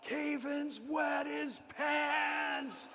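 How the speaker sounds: high, long-drawn schoolkid holler; mock yell. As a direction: stretch it out like a kid announcing to everyone. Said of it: "they yelled out".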